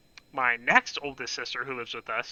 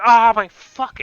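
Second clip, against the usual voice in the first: sudden loud yelp, pitched high; a real jolt of pain in a loud cry; pained shout; 8 semitones higher, 10 dB louder, slower than usual. Retold or imitated. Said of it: "straight up go like".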